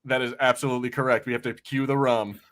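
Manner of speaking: normal talking voice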